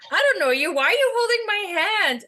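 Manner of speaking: put-on high indignant little-boy voice; kid playing stranger danger. Boy at the bus protests to his caregiver, high and indignant. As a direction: squeal it like a boy faking fear of a stranger. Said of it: "And he's like".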